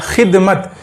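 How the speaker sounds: खिदमत with a rasping kh from the throat, like Urdu ख़; throaty kh sound. Shown as a demonstration. Teacher shows students a throaty खिदमत. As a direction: scrape the kh of खिदमत at the back of the throat. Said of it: "इसके आवाज़ में भी फ़र्क होगा".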